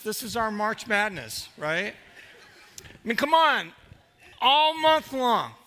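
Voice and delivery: ordinary talk, nothing marked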